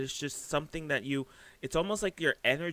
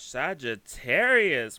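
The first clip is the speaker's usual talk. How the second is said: drawn-out exclamation; 8 dB louder, much slower than usual. Real, not acted. Excited at the card reveal.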